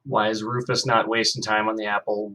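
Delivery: usual speaking voice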